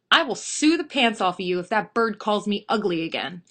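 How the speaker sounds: loud, indignant threat; mad. Acted. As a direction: threaten the owner, fed up. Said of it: "The lady is so mad".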